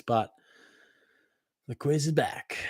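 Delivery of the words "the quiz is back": whispered teaser, higher; conspiratorial whisper. Acted. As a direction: breathe it like a secret. Teasing the quiz's return.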